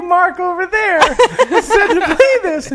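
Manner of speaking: high, loud quote squeezed through laughter; helpless laughing retelling. Retold or imitated. Comic retells bar argument to co-host, laughing helplessly. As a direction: imitate the bar patron while cracking up.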